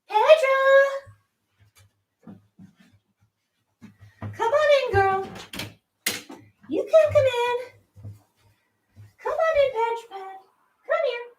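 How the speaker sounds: high, sing-song call to the cat; calling a pet in a high voice; high coaxing pet voice. Real, not acted. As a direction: coax the shy cat in, sweet and high.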